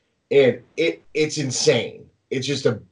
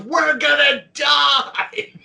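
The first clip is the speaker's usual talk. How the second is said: much higher, slow, mock-panicked cry; mock terror; 12 semitones higher, much slower than usual. Buddy at the coaster's top, hammed-up terrified cry. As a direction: wail it like you really think it's the end. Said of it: "He turns to me, he goes".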